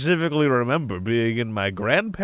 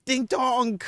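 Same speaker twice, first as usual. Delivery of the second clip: high doorbell chime; 12 semitones higher than usual. Acted. Announcing stream start.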